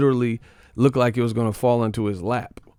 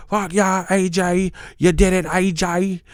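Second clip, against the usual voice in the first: high mocking British fan cheer; 8 semitones higher than usual. Mimicking British fans celebrating AJ's knockouts, high mock British accent. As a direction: cheer AJ on like a rowdy Brit. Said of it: "British teeth in the crowd".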